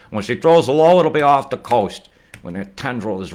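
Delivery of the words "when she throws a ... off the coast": excited build. Real, not acted. Excited storm commentary.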